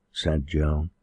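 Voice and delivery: usual speaking voice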